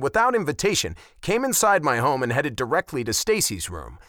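ordinary delivery, nothing marked

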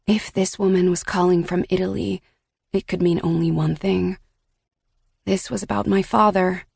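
plain reading voice

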